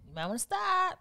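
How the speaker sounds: high, singsong caution; high-pitched mock warning; teasing warning. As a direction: lift it way up as a playful threat. Repeats warning in higher voice.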